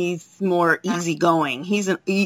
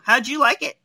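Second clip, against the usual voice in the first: ordinary talk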